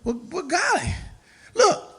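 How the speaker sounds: high, teasing voice egging someone on; high mocking inner voice. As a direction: taunt them like a coach cheering a straggler. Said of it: "what I be thinking in my mind".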